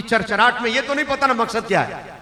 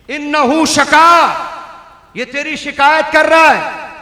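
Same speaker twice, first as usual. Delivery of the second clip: forceful preaching; 7 semitones higher, 8 dB louder than usual. Acted. Preacher proclaims the Prophet's words to the crowd, loud.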